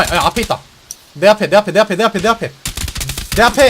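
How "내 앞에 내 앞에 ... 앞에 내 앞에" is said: rising, louder urgent callout. Real, not acted. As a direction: call out the enemy ahead. Enemy right in front.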